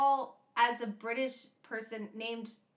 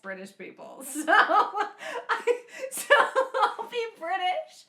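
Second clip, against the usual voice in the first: laughing; 8 semitones higher, 6 dB louder than usual. Real, not acted. Laughing retelling.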